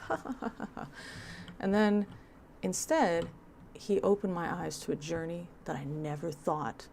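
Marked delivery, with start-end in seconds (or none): 0.1-0.9 high, canned polite laughter; fake group laugh. The group laughing politely at the story, fake high laugh. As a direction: laugh along on cue. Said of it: "And everyone's like".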